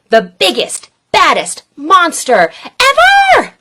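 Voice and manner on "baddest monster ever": louder, higher build to ever; excited shout. A narrator's reading. Reader builds the monster-making plan to an excited shout. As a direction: cry out the climax.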